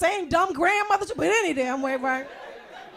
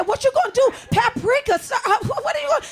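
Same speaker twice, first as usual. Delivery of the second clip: startled question; 6 semitones higher, faster than usual. Retold or imitated. Woman questioning her date, higher startled asking. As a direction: ask it alarmed and confused. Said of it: "I said".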